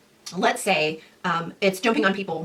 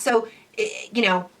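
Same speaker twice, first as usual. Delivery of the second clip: normal talking voice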